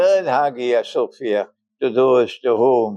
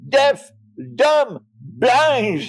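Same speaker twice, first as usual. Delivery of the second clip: angry emphasis; 9 semitones higher, much slower than usual. Acted. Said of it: "This is angry language".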